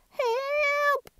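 very high panicked cry; crying for help. Acted. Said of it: "cried the mayor".

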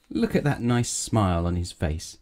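ordinary delivery, nothing marked

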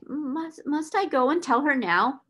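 high, halting anxious question; falter, then plead anxiously; panting. A narrator's reading. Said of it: "panted Miss Amelia".

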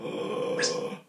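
long, hushed sucking inhale; Mueller maneuver breath. Shown as a demonstration. Singing teacher shows the Mueller maneuver, audible drawn-in breath. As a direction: suck the air in and down. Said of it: "sucking the air in and down".

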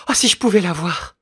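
high, loud, yearning plea; longing cry. Acted. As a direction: beg to see her child. Mother longing to see daughter.

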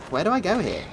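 usual speaking voice

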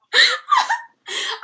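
high squealing laughter; shrieking laugh. Real, not acted. Laughing fit.

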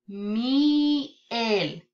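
MIEL stretched into "miii... el", a pause between; in syllables. Shown as a demonstration. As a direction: draw out "miel" slowly in two separate chunks. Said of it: "Ahora en sílabas".

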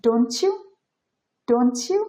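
don't you blended into "donchu", pitch lifting at the end; assimilated don't you. Shown as a demonstration. Teacher showing how natives pronounce it, don't you as "donchu". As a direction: run don't you together with a ch sound, "donchu". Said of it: "এটা উচ্চারণ করবে".

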